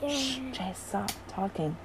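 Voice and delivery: soft shushing aside; hushed aside. Real, not acted. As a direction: hush someone quietly.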